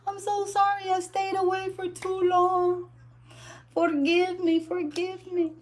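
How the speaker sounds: high, hammed-up weepy plea; mock crying. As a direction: play it as a fake tearful apology. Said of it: "I would just start crying".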